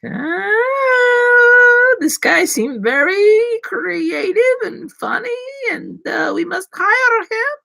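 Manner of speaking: very high, drawn-out mock falsetto; slow, exaggerated high-pitched admiration; gushing future employer. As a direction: squeal it like a smitten employer. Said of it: "all the future employers watching me on LinkedIn, they're like".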